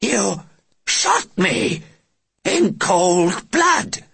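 high, slow, stunned alien voice; shocked alien. Acted. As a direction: gasp it out in disbelief.

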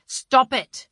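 loud, tense stop it; angry. Acted. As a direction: bite off the order angrily. Angry husband.

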